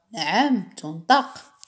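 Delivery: usual speaking voice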